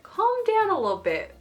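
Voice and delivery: high-pitched squeak on calm down; comically high note to self, swooping back down; self-soothing. Retold or imitated. Retells telling herself to calm down.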